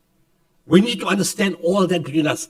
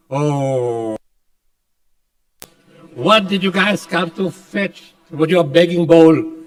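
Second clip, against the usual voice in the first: mocking sneer; 7 dB louder, much slower than usual. Foreign teams mocking them, retold.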